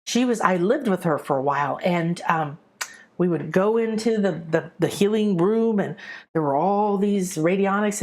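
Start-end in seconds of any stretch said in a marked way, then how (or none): none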